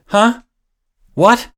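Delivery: high, sharp startled questions; jolted out of a freeze; startled huh. Retold or imitated. Son caught off guard by mom, high startled.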